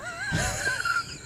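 shrieking, crying laugh; squealing with laughter; high laugh. Real, not acted. Cracking up at repetition.

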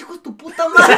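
laughing through a retold cuss-out; mock angry insult. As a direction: hurl the insult, cracking up. Retelling Mike's insult mid-fight.

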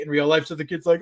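ordinary delivery

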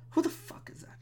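hushed whisper to himself; baffled whisper. Real, not acted. Podcaster wondering who yelled at him, baffled whisper. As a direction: whisper it under your breath.

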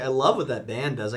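ordinary delivery

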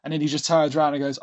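ordinary talk, nothing marked

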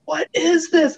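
high-pitched imitation of amazed parents; mock parental astonishment. Parents watching their kids sit through class, acted astonishment.

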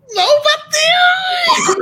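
high, slow, loud disbelieving cry; drawn-out stoned wail. Retold or imitated. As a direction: stretch it out like a high wife who can't believe it. (grito lento e agudo de incredulidade)

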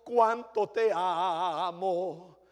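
slow, drawn-out "Cuánto te amo"; mock devotion. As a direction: sigh it out like empty sweet talk. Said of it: "cristianos que son tan fáciles".

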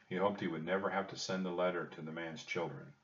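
ordinary delivery

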